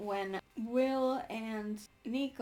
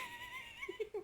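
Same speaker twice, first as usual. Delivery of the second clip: squealing giggle; high squeal of laughter; 10 dB quieter than usual. Real, not acted. Laughing at the emails joke.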